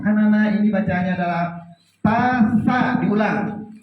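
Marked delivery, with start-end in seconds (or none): 2.0-2.8 tasa sung out high and clear; teacher models tasa for children to echo; modelling for kids. Shown as a demonstration. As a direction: model the word tasa for the class to repeat. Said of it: "Kita mencontohkan".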